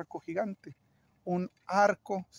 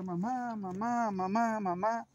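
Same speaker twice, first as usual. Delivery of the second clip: mock child's cry; 4 semitones higher, slower than usual. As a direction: lightly imitate a boy calling out. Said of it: "el niño empieza a gritar". (grito fingido de niño, agudo y contenido)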